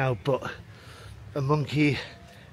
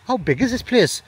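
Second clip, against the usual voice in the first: excited exclaim; 9 semitones higher, 8 dB louder than usual. Real, not acted. Amazed by the park.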